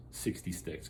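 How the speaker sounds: breathy whispered aside. Pretend. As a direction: drop to a whisper for the number.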